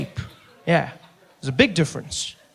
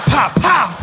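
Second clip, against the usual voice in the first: spank sound effect; 6 semitones higher, 3 dB louder than usual. Acted.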